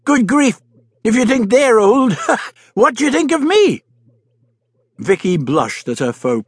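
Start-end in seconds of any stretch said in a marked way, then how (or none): 0.1-3.8 laughing. Acted. Doctor laughing at himself.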